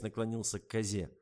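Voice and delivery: ordinary delivery, nothing marked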